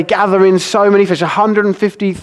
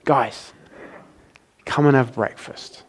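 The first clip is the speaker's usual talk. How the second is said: gentle invitation; 3 semitones lower, 8 dB quieter than usual. Retold or imitated. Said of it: "He says to them".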